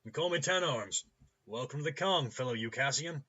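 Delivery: high, loud, hearty welcome; cheerful shout. A narrator's reading. Clinic owner welcoming a newcomer to Kong, cheerful shouting. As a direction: welcome the visitor at full volume, cheerily. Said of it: "shouts cheerfully in English, his words piling together".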